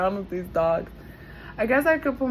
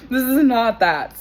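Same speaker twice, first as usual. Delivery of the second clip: loud, hard stress on IS; emphatic; 4 semitones higher, 13 dB louder than usual. Real, not acted. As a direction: insist firmly. Emphatic denial.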